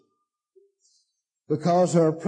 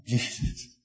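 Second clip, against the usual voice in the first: ordinary talk, nothing marked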